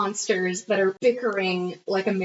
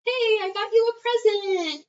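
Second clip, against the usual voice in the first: imitating an excited kid, much higher pitch; high, kid-like present-giving voice; childlike; 12 semitones higher than usual. Man presenting a gift like a kid, childlike. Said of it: "Just like a kid".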